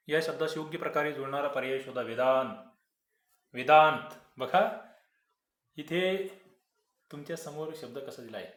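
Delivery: usual speaking voice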